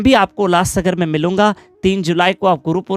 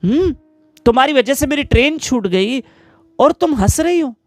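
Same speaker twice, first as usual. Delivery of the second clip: higher-pitched, acted indignation; angry husband; 6 semitones higher than usual. Husband scolds his laughing wife over a missed train, indignant. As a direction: play a husband mildly fuming and demanding answers. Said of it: "मुझे इतना गुस्सा आ रहा है".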